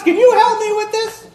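loud, very high-pitched exasperated plea; hammed-up overwhelmed shout; frazzled wife's outburst. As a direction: yell for help amid the chaos. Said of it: "What happened to how I read the text".

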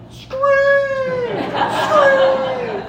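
very high, stretched-out mock yell; high-pitched imitation of a distant yell; imitated sideline shout. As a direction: holler it like a warning from across the field. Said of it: "I can hear on the opposite sideline".

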